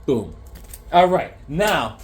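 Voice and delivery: ordinary talk, nothing marked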